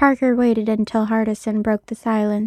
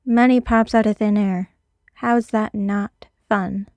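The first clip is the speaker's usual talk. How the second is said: usual speaking voice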